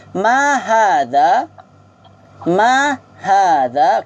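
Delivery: slow, drawn-out Arabic drill phrase; slow model for repeating. Shown as a demonstration. Teacher models the phrase slowly for students to repeat. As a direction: spell out each syllable for the class to echo. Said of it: "cuba ikut sama-sama".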